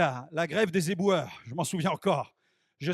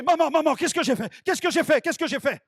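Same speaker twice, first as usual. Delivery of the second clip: high, loud, repeated panicky cry; mock panic; 6 semitones higher, 4 dB louder than usual. Son to his mother after the witch collapses, high mock panic. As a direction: babble it in alarm at your own deed.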